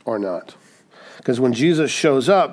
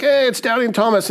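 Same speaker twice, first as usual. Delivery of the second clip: high-pitched mock recognition; excited greeting; 10 semitones higher than usual. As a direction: call out as if spotting a celebrity. Imagined greeting to Thomas in heaven.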